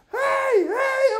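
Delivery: very high, loud mock greeting; excited hailing shout. Imitating friend greeting him from the car, high shout. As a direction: call out like an overexcited driver.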